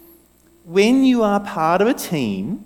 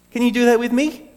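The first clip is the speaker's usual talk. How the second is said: ordinary talk, nothing marked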